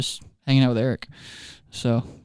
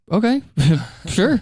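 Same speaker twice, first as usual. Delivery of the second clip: high doubtful okay, sure; unsure okay; 6 semitones higher than usual. Retold or imitated. Pastor humoring the overeager math teacher, high and unsure. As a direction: play along while baffled. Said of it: "And I was like".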